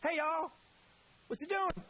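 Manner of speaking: high, friendly Southern greeting; casual Southern hello to old friends. Retold or imitated. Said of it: "That's what he's saying in Southern".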